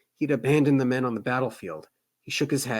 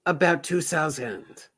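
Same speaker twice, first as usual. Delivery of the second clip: put-on French accent; 4 semitones higher, slower than usual. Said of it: "my French accent".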